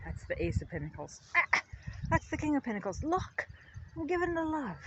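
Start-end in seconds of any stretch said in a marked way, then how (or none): 1.4-4.8 high, soft playful character voice; soft, high card voice. Pretend. Voicing the cards.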